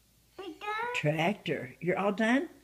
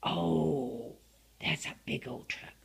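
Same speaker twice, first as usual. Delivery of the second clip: usual speaking voice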